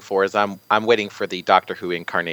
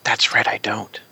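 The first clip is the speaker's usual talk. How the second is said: hushed confession; whisper. Real, not acted. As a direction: whisper it like a guilty secret. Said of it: "Why are we whispering?".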